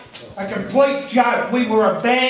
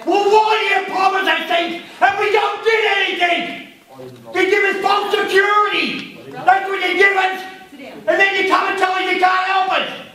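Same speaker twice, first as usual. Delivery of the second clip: raised, higher voice, furious and accusing; angry shouting; 7 semitones higher than usual. Real, not acted. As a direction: shout it at the officials who let you down. Angry resident confronts fire officials.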